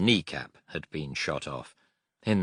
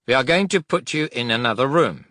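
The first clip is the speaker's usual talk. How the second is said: higher, louder doctor voice; doctor announcing; 8 semitones higher, 7 dB louder than usual. Acted. German doctor announces a move.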